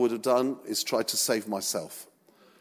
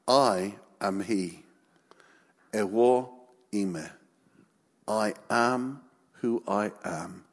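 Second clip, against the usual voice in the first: low, slow and weighty; solemn declaration; 5 semitones lower, 4 dB quieter, slower than usual. Acted. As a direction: let each word land quietly with gravity. Solemn divine declaration by Jesus.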